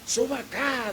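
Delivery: imitated shout. Water-truck driver calls the preacher up, mock shout. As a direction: lightly imitate a man calling someone up. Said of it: "me grita".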